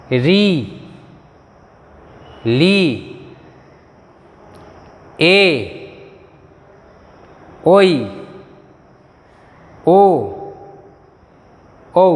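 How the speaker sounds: हर्षोई said hrosh-oi, with Bengali o sound; Bengali vowel names. Shown as a demonstration. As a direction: call out each Bengali vowel for students to repeat. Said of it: "अभी आप बोलने की कोशिश कीजिए".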